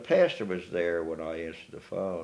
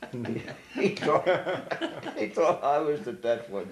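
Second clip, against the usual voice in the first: laughing; 7 semitones higher than usual. Real, not acted. Laughing at the kid's remark.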